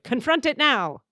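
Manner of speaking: high, emphatic mock demand; demanding eight. Playing her confrontational type eight self, demanding. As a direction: push it out as an impatient order. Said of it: "I'm an eight who's like".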